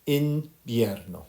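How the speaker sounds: "Invierno" drawn out slowly for repetition; teacher slowly modelling "Invierno"; slow model pronunciation. Shown as a demonstration. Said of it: "Can we all say that?".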